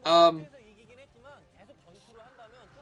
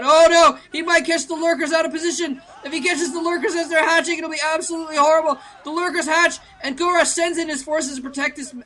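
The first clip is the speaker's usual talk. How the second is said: loud, high-pitched commentary at full tilt; urgent, high esports shout; excited play-by-play; 8 semitones higher, 9 dB louder than usual. Real, not acted. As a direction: call the sudden clash in real alarm, voice raised. Live commentator reacts to sudden battle.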